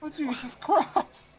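high, slow wail of disbelief; drawn-out high exclamation; exasperated outcry. Real, not acted. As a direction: cry out at the mess they made. Reacts to the explosion.

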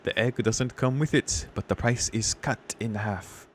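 badly faked French waiter accent, slowing down. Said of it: "Probably the waiter".

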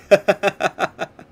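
loud high burst of laughter; delighted laugh. Real, not acted. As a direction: laugh out with glee. Laughs after unlocking gear.

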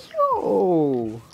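long exclamation sliding down from high; shocked hype reaction. Retold or imitated. Fan reacting to savage insults, drawn-out shocked holler. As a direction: cry out, wowed by the burn.

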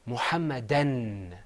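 "Muhammadan" slowly, holding the final n; "Muhammadan" with a long humming n; extra noon sound. Shown as a demonstration. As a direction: draw out the last n of "Muhammadan". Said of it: "it adds an extra noon sound".

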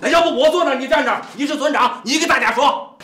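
high, loud, exasperated telling-off; loud scolding. Acted. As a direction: raise your voice to put the heckler in his place. Chief scolds man interrupting village meeting.

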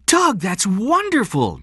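high, loud, drawn-out delight; thrilled. Acted. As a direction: gush to your husband in a high voice. Diane thrilled by husband's news.

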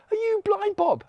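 very high, astonished query; incredulous question. Acted. Crowd questions the healed beggar, amazed. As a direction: ask in high-pitched disbelief.

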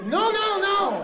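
high, loud "não, não, não"; mock angry no. Irritated clerk refusing his pronunciation, high loud protest. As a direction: snap the nos back high and loud. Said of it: "eles vão ficando bravos".

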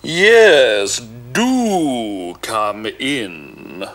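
big, slow booming ogre voice, loud on yes. Acted. Ogre inviting the cat in to eat him, slow booming. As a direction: boom it out like a giant. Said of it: "boomed the ogre".

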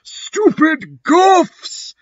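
very high, drawn-out mock screech; Dumbledore's angry shouting. A narrator's reading. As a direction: screech the insult slowly and angrily. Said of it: "shredding at us angrily".

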